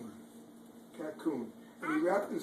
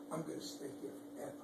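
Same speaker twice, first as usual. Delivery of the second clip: fast contented whisper; 10 dB quieter, much faster than usual. Acted. Ladybug contented.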